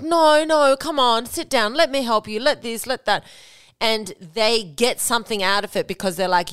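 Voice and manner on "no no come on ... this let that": high, insistent mock pleading; begging. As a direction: act out coaxing a sulking partner to accept help. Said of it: "expect for you to then beg".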